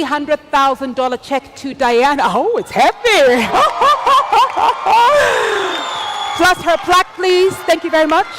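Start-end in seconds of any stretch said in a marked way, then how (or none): 3.5-6.3 real delighted laugh; big laugh. Real, not acted. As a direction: laugh at the heavy check. Laughing at heavy check.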